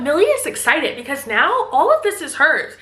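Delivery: ordinary talk, nothing marked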